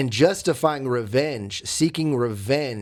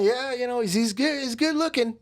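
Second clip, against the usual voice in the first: higher, strained-casual going along; feigned agreement; 10 semitones higher than usual. Retold or imitated. Insecure boyfriend agreeing with girlfriend, mock casual. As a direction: play along as if unbothered.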